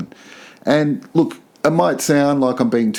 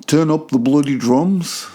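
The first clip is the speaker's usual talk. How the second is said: bad Liverpudlian accent; 5 semitones higher than usual. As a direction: put on a poor Scouse accent, pitched up and forceful. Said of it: "that's a bad Liverpudlian accent".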